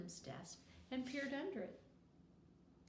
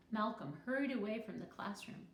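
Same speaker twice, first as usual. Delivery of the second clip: usual speaking voice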